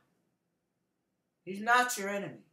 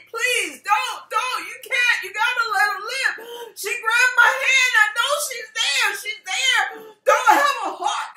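high, loud frantic begging; desperate pleading; 12 semitones higher, 7 dB louder than usual. Retold or imitated. Boyfriend begging the doctor to spare her, frantic pleading. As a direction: plead as if a life depends on it. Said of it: "begging and pleading".

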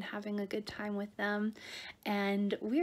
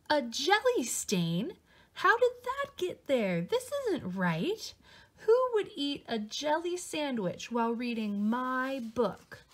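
slow, disgusted and offended; who dared do this; indignant; 2 semitones higher, slower than usual. Acted. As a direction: Louie finds jelly on his book, indignant. Louie upset at stained book.

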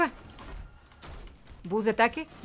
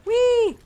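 high, loud "whee"; gleeful squeal; 12 semitones higher, 6 dB louder than usual. Real, not acted. Player moving fast as Lucio, gleeful squeal. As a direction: squeal like riding along fast.

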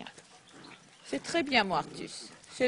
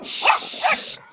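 loud shush; 10 dB louder than usual. Real, not acted. Hushing barking dogs.

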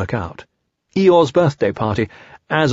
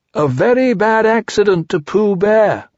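raised and higher, a touch louder; loudly; 8 semitones higher, 3 dB louder than usual. Acted. Pooh testing his voice, saying it loudly. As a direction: test your voice out loud. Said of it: "he said loudly".

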